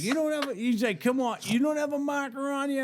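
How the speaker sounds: high-pitched disbelief; retold pleading challenge; incredulous questioning. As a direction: press him in an imitated high voice. Truck driver challenges his denial.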